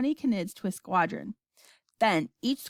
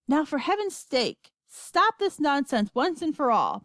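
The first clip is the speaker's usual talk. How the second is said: raised, exasperated telling-off; stern; 6 semitones higher than usual. A narrator's reading. Wonka stopping the grandmas' nonsense, stern. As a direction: scold firmly, pitch lifted. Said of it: "Mister Wonka said sternly".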